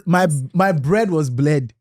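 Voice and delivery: "bread" said as "bled", slowly; bad Igbo accent. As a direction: mimic swapping r for l in "bread". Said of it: "bad Igbo accent".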